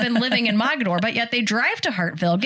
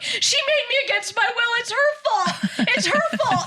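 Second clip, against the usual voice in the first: high, laughing whine; high tattling whine to the cop; whiny blaming. Retold or imitated.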